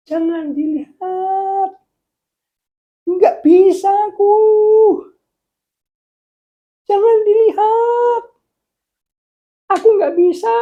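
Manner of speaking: very high, loud, drawn-out wail; panicked wail. Acted. Man caught by God's eye begging not to be seen, panicked high wail. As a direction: wail it out in a squeaky put-on voice. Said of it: "dia bilang gini".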